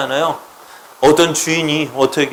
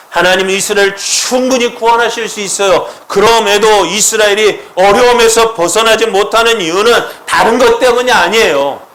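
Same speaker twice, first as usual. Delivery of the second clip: loud, high-pitched impassioned preaching; full-force preaching; 7 semitones higher, 5 dB louder than usual. Acted. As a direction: build it up and proclaim it with full force. (높고 크게 외치는 설교)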